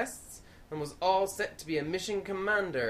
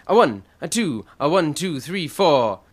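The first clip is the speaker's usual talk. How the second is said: count-in; 13 dB louder than usual. Acted. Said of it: "sing you all a song".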